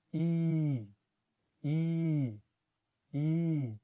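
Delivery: long English "I" said as ee with lips stretched wide in a smile; smiling long ee. Shown as a demonstration. Teacher showing Spanish speakers the smiling long ee. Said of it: "exagerar un poquitico al estirar más los labios".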